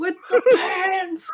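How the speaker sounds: loud laughing "With the pants". Real, not acted. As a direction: shout it through laughter. Laughing callback shout.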